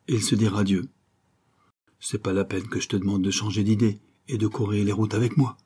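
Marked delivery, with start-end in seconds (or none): none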